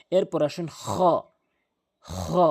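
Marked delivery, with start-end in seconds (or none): 2.0-2.4 খ rasped from the back of the throat, much lower; throaty demo. Shown as a demonstration. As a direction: scrape খ deep in the throat. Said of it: "আমি যেভাবে সাউন্ডটি করছি".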